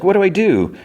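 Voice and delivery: high-pitched anxious question; retold urgency. Fiona asks Cake how to help Finn, acted urgency. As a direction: act out someone eager to help in a crisis.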